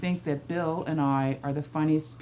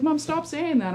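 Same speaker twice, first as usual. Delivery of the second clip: put-on higher, whiny teen protest; exasperated son; 9 semitones higher than usual. Son begging his mother to stop, higher and whiny. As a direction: plead with mom to stop. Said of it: "he's like".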